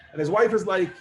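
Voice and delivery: ordinary talk, nothing marked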